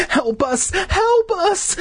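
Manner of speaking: high squealed cries for help; hammed-up shriek; desperate pleading. A narrator's reading. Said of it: "plead at the top of his lungs".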